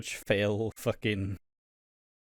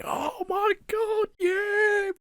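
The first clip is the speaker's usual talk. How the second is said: very high, drawn-out mock shriek; Linda; 4 dB louder, much slower than usual. Podcaster acts Linda hearing of the pregnancy, hammed-up shrill excitement. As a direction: squeal it slowly, hammed-up and overexcited. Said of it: "then Linda's like".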